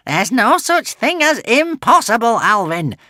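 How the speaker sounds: a scheming witch unimpressed; witch sighing. Acted. Witch correcting Alvin, weary witchy sigh. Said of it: "sighed the witch".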